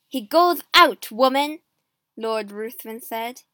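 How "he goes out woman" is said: loud, overbearing order; angry command. A narrator's reading. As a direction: overrule her at full voice. Lord overrules the queen.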